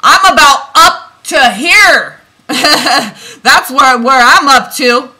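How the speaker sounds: exasperated shout breaking into a laugh; fed up, half laughing while shouting; angry, laughing. Real, not acted. Reader fed up with viewers, loud exasperated laugh. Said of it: "I'm getting angry".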